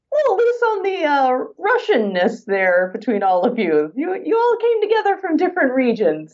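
lightly put-on Russian accent; a hint of a Russian friend teasing; Russian friend's critique. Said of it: "friend who's actually Russian ... was like".

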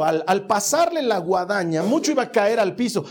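rising sermon voice, pushed and emphatic; fervent preaching. Acted. Pastor urging the congregation to forgive, fervent preaching.

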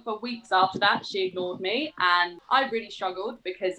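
ordinary talk

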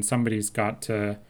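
ordinary delivery

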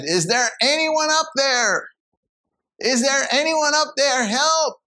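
high, pleading cry upward; screamed for help. Acted. Climber slipping off a ledge, high desperate call. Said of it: "he screamed out to the top".

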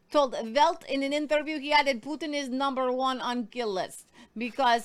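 put-on loud Russian accent read; news read in a hammed-up Russian accent. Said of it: "I absolutely love doing the Russian accent".

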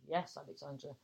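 ordinary talk

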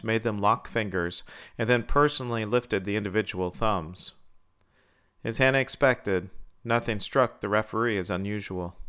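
usual speaking voice

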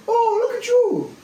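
high cooing Irish lilt; old Irish man. Retold or imitated. Old Irish man in Ireland teasing him, high coo. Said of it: "looks at me and goes".

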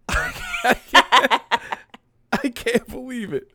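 high-pitched, through helpless laughter; laughing disbelief. Real, not acted. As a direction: laugh while trying to get it out. Laughing at the plot twist.

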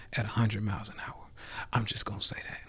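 a fast, confiding whisper. Real, not acted. Confessing the speeding to viewers in a whisper. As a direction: drop to a whisper like it's a guilty secret.

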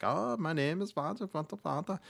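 high-pitched, silly Swedish sing-song; mock Swedish accent. Actress showing the director what she can do, mock Swedish accent. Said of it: "I guess I can do Swedish".

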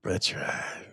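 breathy whispered agreement. Real, not acted. Host agreeing softly to his co-host, whispered.

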